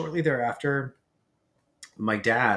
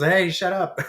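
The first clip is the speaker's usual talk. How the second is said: louder, higher mock holler; retold yell; 7 semitones higher, 6 dB louder than usual. Older brother yelling upstairs at little brother, mock shouting. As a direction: imitate yelling up at a noisy kid. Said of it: "I am screaming upstairs".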